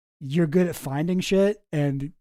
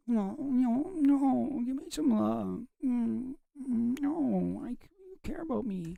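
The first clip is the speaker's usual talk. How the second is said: high, soft mocking whine; whiny boyfriend voice; 8 semitones higher, 7 dB quieter, slower than usual.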